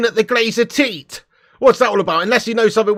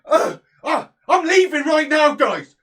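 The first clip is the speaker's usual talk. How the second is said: mock huff; 10 semitones higher, slower than usual. Mock storming off.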